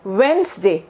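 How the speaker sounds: "वेडनेसडे" as wens-day; two-syllable "वेडनेसडे", first D dropped; silent D. Shown as a demonstration. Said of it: "डी है इसका sound नहीं है".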